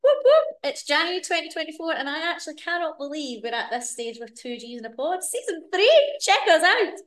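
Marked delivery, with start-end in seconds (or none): 0.0-0.6 high, loud celebratory whoop; excited whoop. Real, not acted. Cheering to her co-host about season three, a loud high whoop. 5.2-6.9 excited. Real, not acted. Hyping the new season.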